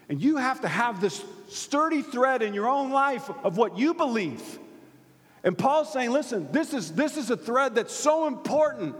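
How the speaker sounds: high, urgent preacher's delivery; forceful preaching. Real, not acted. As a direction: press the point home to the congregation.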